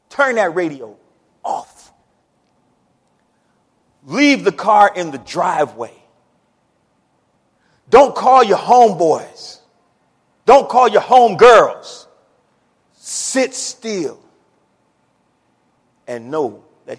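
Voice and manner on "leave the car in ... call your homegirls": full-force preaching. Acted. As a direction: build it up and thunder.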